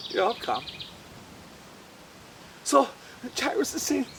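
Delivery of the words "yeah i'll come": much lower and soft; quiet reassurance; calm low Jesus voice. Acted. Jesus answers calmly.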